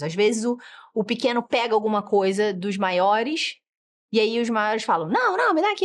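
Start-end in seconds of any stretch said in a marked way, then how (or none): 5.1-5.8 high-pitched, urgent grabby protest; mock frantic sibling demand; agitated child's demand. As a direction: imitate a worked-up kid demanding it back. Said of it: "Cara, calma".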